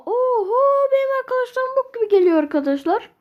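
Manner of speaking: whiny mock cry. Real, not acted. Takım arkadaşlarına ağlamaklı yakınma.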